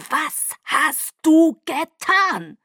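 sehr langsam, höher und lauter; wütende Frage. Acted. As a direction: jedes Wort wütend gedehnt. Bella stellt Jacob wütend zur Rede. (Bella fordert von Jacob Rechenschaft, laut und gedehnt)

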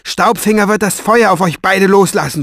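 high, loud angry threat; defiant shout. A narrator's reading. Farid threatens the men holding him, shouting. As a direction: shout a threat at both captors. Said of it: "schrie er".

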